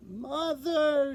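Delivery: put-on high, small squeak of a lost baby; high little squeak; squeaky call. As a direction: call out for mother, frightened. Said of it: "Stellaluna squeaked".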